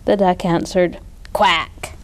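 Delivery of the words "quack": plain flat quack; duck's quack. A narrator's reading. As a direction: answer like a dutiful duck. Duck voiced in read story.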